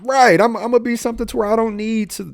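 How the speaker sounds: high, fast boastful voice, acting out his teenage self; a young man sure he'll be somebody; mock cocky youth. Retold youthful bragging about future.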